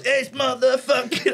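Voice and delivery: high, stretched-out bear cry; mock hype announcer. Bear interrupting the reunion with dad, mock hype announcing. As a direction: blare it over the other person, slowly.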